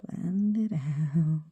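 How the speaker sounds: slow soft whisper. Real, not acted. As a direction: whisper it while concentrating. Whispered while working.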